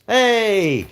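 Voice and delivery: loud, high "Hey!" called out; sudden shouted hey. Real, not acted. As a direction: bellow it to get attention. Sudden call-out on stream.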